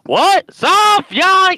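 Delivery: high, shouted Southern Shakespeare, very drawn out. Retold or imitated. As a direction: bellow each word slowly, way up high. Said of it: "I can see G E".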